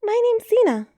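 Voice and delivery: put-on a small girl answering the door politely; high child voice; little girl voice. A narrator's reading. Little girl introduces herself.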